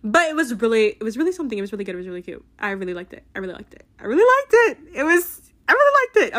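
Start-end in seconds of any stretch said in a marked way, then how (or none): 1.6-3.9 quiet, trailing praise. Real, not acted. Repeating praise, fading before louder take. 4.0-6.4 high, loud fangirl squeal; squealed, emphatic enthusiasm; gushing insistence. Real, not acted. Repeats praise, bursting out excitedly.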